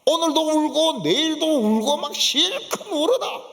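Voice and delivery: high, emphatic acted urging; 실컷 울라는 외침. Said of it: "우리 같으면은". (목청 높여 실컷 울라고 부추기듯)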